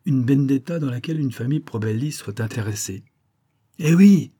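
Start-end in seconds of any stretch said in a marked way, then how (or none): 3.8-4.3 loud, much higher outburst; crying out. A narrator's reading. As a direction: blurt it out loud and high. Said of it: "cria l'autre". (s'écrier fort et aigu)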